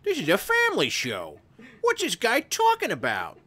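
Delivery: hammed-up outraged librarian; mock librarian. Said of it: "some librarian in, like, Hyannisport is like".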